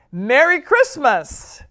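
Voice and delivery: slow, loud hearty holiday call. Acted. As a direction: boom it from the doorway. Grandpa calls from front door.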